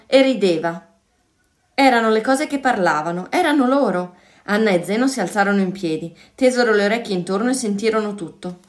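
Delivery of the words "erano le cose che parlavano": ordinary delivery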